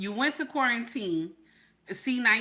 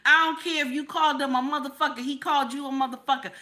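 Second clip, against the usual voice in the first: normal talking voice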